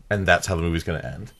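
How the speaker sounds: ordinary talk, nothing marked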